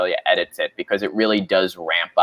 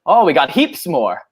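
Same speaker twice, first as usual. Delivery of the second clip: a light Australian "heaps", higher; Aussie accent; 6 semitones higher than usual. Retold or imitated. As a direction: quote the housemate at breakfast, light Aussie accent. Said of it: "And the guy was like".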